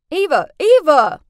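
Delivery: loud repeated call of a name; urgent calling out. Acted. Ex calling to the screaming narrator, urgently. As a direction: call out to get through to someone panicking.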